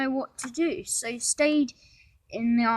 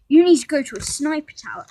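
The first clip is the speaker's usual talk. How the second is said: commanding order; 4 semitones higher, 10 dB louder, faster than usual. A narrator's reading.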